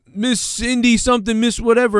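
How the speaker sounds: high, mocking reporter calling her name; pushy reporter. Reporter calling over the grieving woman, mocking high pitch. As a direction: imitate a pushy TV reporter cutting in. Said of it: "He was like".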